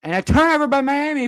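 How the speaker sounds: urgent play-by-play burst; excited turnover call. Real, not acted. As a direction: pounce on the sudden steal. Calling a sudden turnover.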